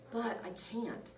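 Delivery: usual speaking voice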